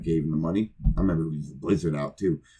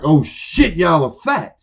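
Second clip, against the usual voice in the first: shocked blurt; 9 semitones higher, 7 dB louder, slower than usual. Retold or imitated. Shocked blurt at indie show.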